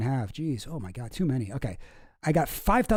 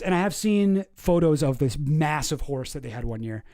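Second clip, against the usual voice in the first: ordinary talk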